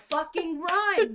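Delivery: very loud, slow, drawn-out yell; furious shout. Real, not acted. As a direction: bellow it in exasperation at the victim. Yelling at screen character.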